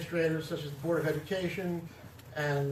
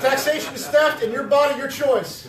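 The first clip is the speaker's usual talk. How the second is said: much louder and higher slogans, laughing; laughing shout; 9 semitones higher, 12 dB louder than usual. Real, not acted. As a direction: belt the slogans out through a laugh. Closing slogans with time running out.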